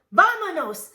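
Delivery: high, loud Spanish shout; rally the gang to go; rallying cry. A narrator's reading. Skipito rallies the pack.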